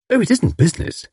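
wife cheerfully waving off her husband's jealousy. A narrator's reading. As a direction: brush it off cheerfully. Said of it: "said Tuppence cheerfully".

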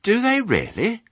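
very high little-pig question; amazed piglet. Acted. Piglet shocked by sheep's tales, high and amazed. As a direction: ask in wide-eyed surprise.

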